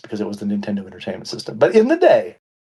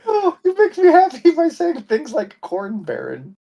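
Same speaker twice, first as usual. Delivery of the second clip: delighted; slower than usual. Real, not acted. Delighted after corn baron.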